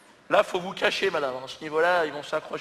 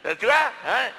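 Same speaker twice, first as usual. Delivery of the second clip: high, loud call to the group; rally the others, hammed-up; caveman calling the others; 8 semitones higher, 6 dB louder than usual. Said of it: "il y en a un qui a fait".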